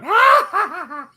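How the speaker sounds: loud, high-pitched staged cackle; mock villain laugh. Cohost imitating the dude on the villain belt logo, mock laugh. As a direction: burst into a hammed-up gloating laugh. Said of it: "just gonna have some dude laughing".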